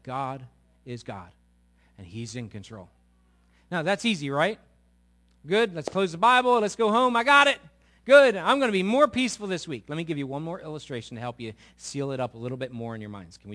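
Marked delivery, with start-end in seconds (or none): 3.7-9.8 much higher and louder, sarcastic cheer; high, loud mock-cheerful brush-off; mock triumph. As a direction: lampoon the listener who thinks he's done. Mocking overconfident congregant.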